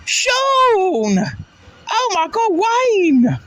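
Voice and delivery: shrieked very high and loud, calling names; excited shout. Retold or imitated. Friend spotting them outside the pub, shrieking their names in excitement. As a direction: yell their names in sudden delight. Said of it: "all I hear is".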